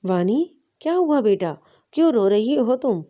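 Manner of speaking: usual speaking voice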